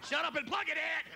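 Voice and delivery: high-pitched impatient yell; screamed retort. Acted. Screamer snaps back in bed.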